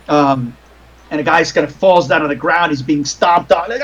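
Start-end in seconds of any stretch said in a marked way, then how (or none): none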